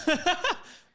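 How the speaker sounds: high laugh. Real, not acted. Laughs at the deals answer.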